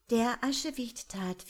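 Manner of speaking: plain reading voice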